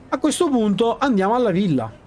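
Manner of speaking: ordinary talk, nothing marked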